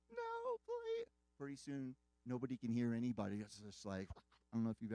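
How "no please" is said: high, thin mock cry, held back in volume; retold desperate plea. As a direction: imitate a far-pitched pleading wail, softly. Mother pleading as her boy heads away.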